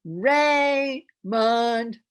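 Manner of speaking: loud, high call across the yard; mother calling child. Shown as a demonstration. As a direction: call your child home for dinner. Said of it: "Watch this".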